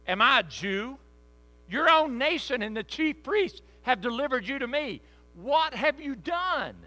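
high, loud, scornful Roman governor; indignant Pilate. Acted. Pilate challenges Jesus before the crowd, loud and scornful. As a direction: fire the questions back at Jesus, affronted and high. Pilate voiced in scripture reading.

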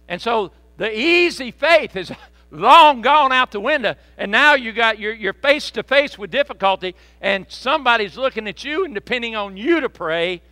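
loud, high preacher's cry; full-voiced revival preaching; preaching at full force. Real, not acted. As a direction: lift the congregation with fervour.

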